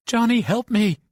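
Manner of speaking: high, loud desperate plea; crying for help. Acted. Bobby begs Johnny through the wall, high and frightened. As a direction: beg him for help, frightened. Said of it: "Bobby cried".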